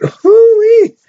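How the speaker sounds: high, loud hammed-up whoop of delight; mock whoop. Teacher's mock German whoops at the smell, high and loud. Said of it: "a German person be like".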